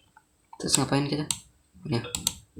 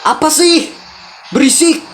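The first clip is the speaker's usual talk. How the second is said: high-pitched irritated outburst; angry scolding; 10 semitones higher than usual. Acted. Husband tells wife to go away, angry scolding. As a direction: tell her off, pitch jumping right up.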